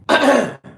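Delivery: hard, loud throat clear; real, forceful throat clearing. Real, not acted. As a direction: clear a throat strained by a silly voice. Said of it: "My throat".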